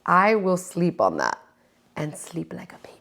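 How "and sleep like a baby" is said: whisper. Real, not acted. Soft punchline about sleeping.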